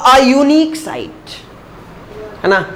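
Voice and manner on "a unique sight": a unique sight read very slowly, unique as yoo-neek; slow demo reading. Shown as a demonstration. Teacher modelling the example, very slow. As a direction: stretch out a unique sight for the class. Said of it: "अब".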